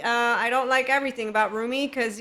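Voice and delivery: ordinary delivery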